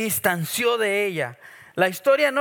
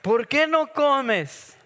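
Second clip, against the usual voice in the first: slow, drawn out, raised mock question; mock husband question; 6 semitones higher, 4 dB louder, much slower than usual. Preacher mimicking Elcana asking his grieving wife, slow and raised. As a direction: stretch it out like a clueless husband. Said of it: "la otra pregunta".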